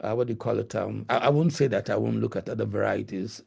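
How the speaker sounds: ordinary talk, nothing marked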